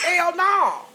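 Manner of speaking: loud, high outburst; emphatic refusal. Real, not acted. Mother rejecting heroin offer with a loud high shout.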